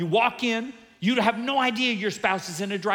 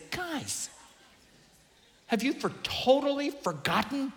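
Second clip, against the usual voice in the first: high, exasperated, soft scolding plea; 8 semitones higher, 4 dB quieter than usual. Retold or imitated. Pastor scolding ungrateful people, high exasperated plea. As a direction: shake them awake with a high pleading voice. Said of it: "grab 'em by the nostrils and say".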